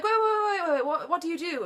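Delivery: high, eager calling out after her. Retold or imitated. Guy in the car calling after a woman walking away, high and eager.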